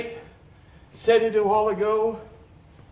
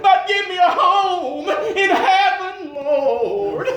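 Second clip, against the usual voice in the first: sung-out cry to the Lord, louder and higher; pleading prayer; 5 semitones higher, 4 dB louder than usual. Retold or imitated. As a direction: beg the Lord in a half-sung wail. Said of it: "on my knees I said".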